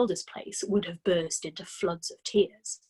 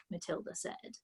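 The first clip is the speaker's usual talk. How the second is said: ordinary delivery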